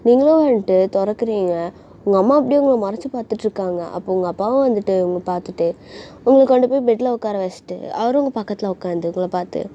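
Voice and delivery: ordinary delivery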